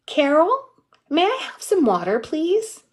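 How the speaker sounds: put-on a small boy trying out her first name; little boy, cheeky. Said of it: "And then he goes".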